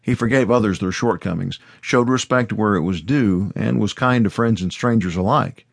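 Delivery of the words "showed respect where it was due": ordinary delivery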